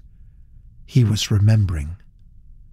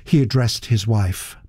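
ordinary delivery, nothing marked

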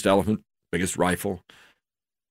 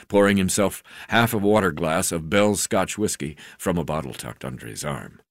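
usual speaking voice

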